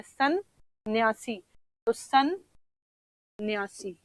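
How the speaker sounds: सन्यासी spoken very slowly, syllable by syllable; slow careful pronunciation. Shown as a demonstration. Teacher modelling सन्यासी slowly for students. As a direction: draw out सन्यासी for the class to copy. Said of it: "दो बार आप रुके हैं".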